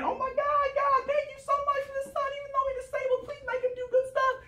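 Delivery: high, pleading thanks; grateful pastor prayer. Acted. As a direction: beg God sweetly with the voice up high. Pastor thanks God for son.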